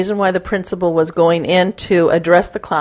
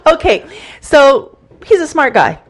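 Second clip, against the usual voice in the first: laughing; 6 semitones higher than usual. Real, not acted. Host laughing after French joke.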